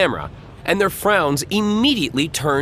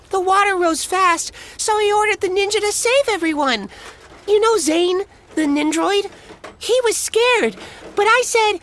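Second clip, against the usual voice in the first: put-on much higher, rambling kid voice; little boy tall tale. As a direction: spin it like a kid making things up.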